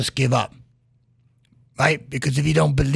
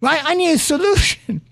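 exasperated outburst; 10 semitones higher than usual. Retold or imitated. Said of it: "I go".

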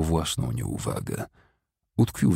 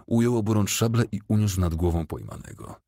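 ordinary delivery

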